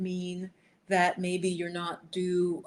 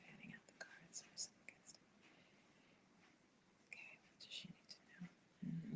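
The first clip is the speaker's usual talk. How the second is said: soft unvoiced whisper, much softer; whispering to herself; 2 semitones lower, 12 dB quieter than usual. Real, not acted. Tarot reader whispers to herself while fanning the cards. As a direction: hush it like a private ritual.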